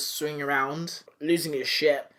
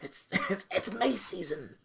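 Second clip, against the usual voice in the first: high, slow, hushed hunter voice; mock hushing; 6 semitones higher, 3 dB quieter, much slower than usual. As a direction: draw it out, shushing gleefully. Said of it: "just shushing him".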